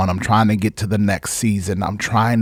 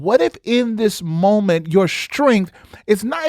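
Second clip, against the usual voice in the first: ordinary talk, nothing marked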